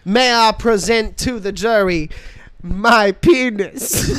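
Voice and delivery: honeyed Southern lawyer drawl; slow Southern courtroom drawl through laughs. Pretend. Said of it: "like, a Southern defense lawyer, he's like".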